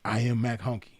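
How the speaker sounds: a quiet self-proclamation; mirror affirmation. Shown as a demonstration. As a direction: declare it to yourself twice. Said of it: "let it be known".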